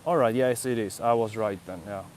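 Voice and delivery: usual speaking voice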